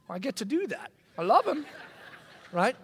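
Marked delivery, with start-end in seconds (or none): none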